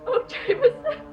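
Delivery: sobbing, pitched high and breaking; crying. Acted. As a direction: cry through his name. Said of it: "Why are you crying?".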